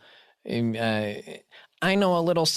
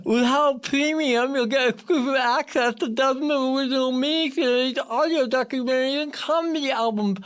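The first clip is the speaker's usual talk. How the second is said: talking with tongue pinched; clothespin on tongue; 9 semitones higher than usual. Real, not acted. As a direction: speak with your tongue clamped. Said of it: "putting a clothespin on my tongue".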